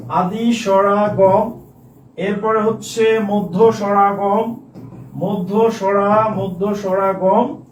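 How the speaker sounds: slow dictation, each term drawn out; spaced, deliberate teacher dictation. Shown as a demonstration. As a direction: read the terms slowly so students can write them. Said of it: "dictating terms for notes".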